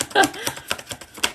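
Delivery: airy rising laugh; high breathy giggle. Real, not acted. Reader cracking up at her own joke, airy giggle.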